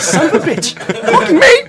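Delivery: high, loud laughing exclamation; exasperated outburst. Retold or imitated. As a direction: blurt it out in disbelief. Realising Vivid means a texter.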